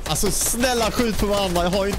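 high-pitched real plea to the others; desperate pleading. Real, not acted. Player begs the others to shoot each other, desperate pleading. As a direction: beg them, pitched well up.

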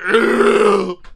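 loud, high groan of revulsion; disgusted "ugh". Real, not acted. Reacting to what the cat ate, loud disgusted groan. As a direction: blurt out a big grossed-out noise.

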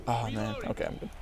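ordinary delivery

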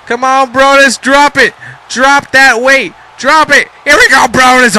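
high, loud cheering on; excited urging. Real, not acted. Commentator urging Brodus on, high and loud. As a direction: egg him on to drop the weight.

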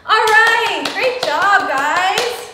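cheerful praise. Real, not acted. Teacher cheering children after the song, loud. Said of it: "praising kids".

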